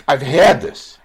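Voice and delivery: real emotional burst, had stressed; emphatic outburst. Real, not acted. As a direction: blurt it out from personal pain. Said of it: "sorry for getting so emotional".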